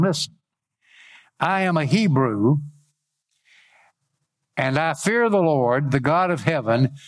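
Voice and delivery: declaimed Bible reading, lifted and deliberate; scripture reading. Acted. Preacher reads the sailors questioning Jonah, declaimed. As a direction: proclaim each verse phrase by phrase with pauses.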